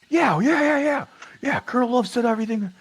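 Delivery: very high, loud mock major voice; dismissive major. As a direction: brush it off in a squawky rush of yeahs. Acts major brushing off briefing question.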